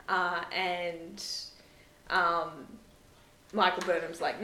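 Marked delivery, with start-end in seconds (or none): none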